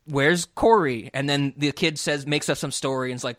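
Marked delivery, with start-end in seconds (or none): 0.0-1.1 mock parent. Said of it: "they're like".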